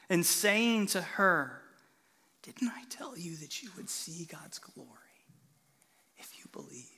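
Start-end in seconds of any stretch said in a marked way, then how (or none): 2.4-6.9 whispered softly and gently, close to her; tender whisper. Shown as a demonstration. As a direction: whisper it like comforting a grieving sister. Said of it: "I just imagine him looking at Martha".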